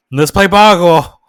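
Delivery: loud, drawn-out rallying call; hammed-up game-night announcement; eager Peggy. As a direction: call it out slowly and loudly. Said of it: "when Peggy was like".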